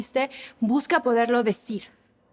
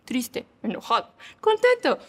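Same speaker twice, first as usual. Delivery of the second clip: lightly hammed-up sad, angry, happy; a hint of Capulina cycling through moods; mock Capulina faces; 3 semitones higher than usual. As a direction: act out each emotion in turn, comically. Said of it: "Capulina hacía las caras así de triste, enojado, contento".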